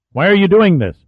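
pressing demand for an answer. A narrator's reading. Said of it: "he demanded".

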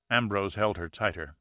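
usual speaking voice

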